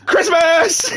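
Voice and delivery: high, loud laughing cry; laughing exclamation. Real, not acted. Reader cracking up at a Santa hat, laughing. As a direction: burst out laughing.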